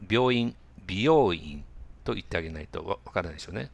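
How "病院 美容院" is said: 病院、美容院 drawn out slowly, louder, each syllable separate; slow and distinct. Shown as a demonstration. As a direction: spell out 病院、美容院 slowly for a learner. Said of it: "ゆっくり言ってあげないと". (「病院、美容院」をゆっくりはっきり)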